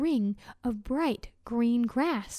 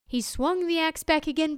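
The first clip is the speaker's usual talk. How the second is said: ordinary delivery, nothing marked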